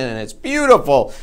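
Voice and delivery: ordinary talk, nothing marked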